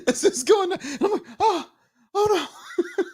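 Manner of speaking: very high, breaking up mid-sentence; helpless high squeal. Real, not acted. Streamer watching show fall apart, helplessly squealing. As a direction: squeak it out, unable to finish.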